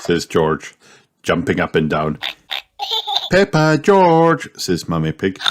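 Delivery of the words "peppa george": high, slow motherly call. A narrator's reading. Mum stopping her children, high drawn-out calling. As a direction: stretch the names to summon children. Mummy Pig calls the children.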